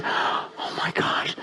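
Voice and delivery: slow, hushed whisper of awe; awestruck whisper. Acted. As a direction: whisper it slowly, as if overwhelmed. Acts out awestruck realisation.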